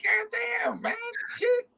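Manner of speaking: very high falsetto cursing; high-pitched retelling. Retold or imitated. Cursing after the exam, mock high-pitched voice. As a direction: squeak it out as if just squeezed. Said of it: "I was talking high pitched and shit".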